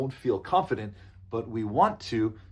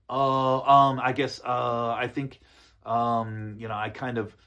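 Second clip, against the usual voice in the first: drawn-out "uh" and "um", stalling; hesitant filler; 3 dB louder than usual. Shown as a demonstration. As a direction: stall for an answer with long fillers. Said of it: "don't immediately start".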